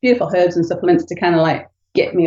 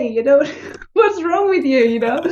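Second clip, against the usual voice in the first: high, laughing New Zealand accent imitating her husband; Kiwi accent impression; 8 semitones higher than usual. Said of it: "he's a Kiwi".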